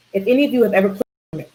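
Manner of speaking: normal talking voice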